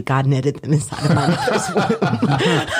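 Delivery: slow delivery cracking into laughter; laughing punchline. Retold or imitated. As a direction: draw it out and lose it laughing.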